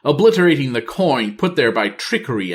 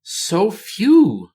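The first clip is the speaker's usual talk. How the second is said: dismayed; 9 semitones higher than usual. Acted. Queen dismayed by forces.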